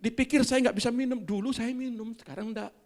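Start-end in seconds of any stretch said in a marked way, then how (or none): none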